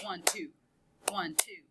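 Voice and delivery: "one, two" counted with weight on "two"; stress on second beat. Shown as a demonstration. Teacher demonstrates clap method, "one, two" with "two" stressed. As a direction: count "one, two" softly in pairs, leaning on "two". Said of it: "more emphasis on the one, two, on the two".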